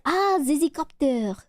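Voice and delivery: imitating a French friend catching on; French-accented "ah" of recognition; mock French accent, drawn from a friend. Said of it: "French speaker".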